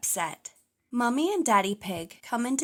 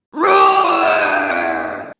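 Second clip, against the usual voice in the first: huge loud, much lower roar; dinosaur roar; 13 dB louder than usual. A narrator's reading. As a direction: bellow like a monster toy. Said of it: "Dino Roar sings".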